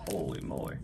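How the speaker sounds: hushed whisper under breath. Real, not acted. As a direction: whisper it while straining. Straining to open tight pack.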